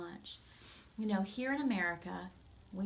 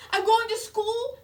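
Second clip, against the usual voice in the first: much higher, much louder joyful squeal; 14 semitones higher, 14 dB louder than usual. Acted. George telling his mother he can go to school, thrilled squeal. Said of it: "George squealed".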